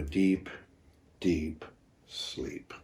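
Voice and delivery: dropping to a sleepy whisper; fading into sleep. Pretend. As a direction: let it drift off to nothing.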